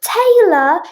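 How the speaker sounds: high, loud searching call. A narrator's reading. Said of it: "he called even louder".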